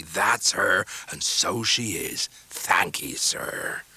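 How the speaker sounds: slow rough fisherman voice; a homely old sailor, deeply moved; Mr Peggotty. A narrator's reading. Mr Peggotty welcoming guests by fire, rough sailor voice.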